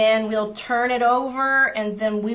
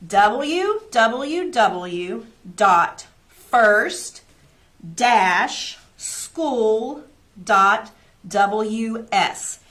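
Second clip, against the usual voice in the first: very slow, louder, every letter apart; slower, louder repeat; 4 dB louder, much slower than usual. Shown as a demonstration. Teacher repeating the website for parents, slower and louder. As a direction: dictate it once more, slower still. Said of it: "we'll say it one more time".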